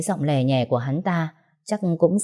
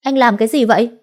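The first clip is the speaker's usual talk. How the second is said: higher, louder sharp shout; loud scolding; 6 semitones higher, 5 dB louder than usual. Acted. She defends her slapped friend, loud angry shout. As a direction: shout him down in anger. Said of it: "quát rõ to".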